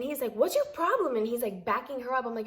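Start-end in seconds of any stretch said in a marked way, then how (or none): 0.3-1.2 mock angry snap. Husband snapping at her.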